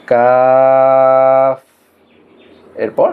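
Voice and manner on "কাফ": কাফ held long and level, without a push; natural, unforced recitation. Shown as a demonstration. Teacher showing it is said without a push, কাফ drawn out smoothly. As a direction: stretch কাফ smoothly on one even note. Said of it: "এটা স্বাভাবিকভাবে উচ্চারণ হবে".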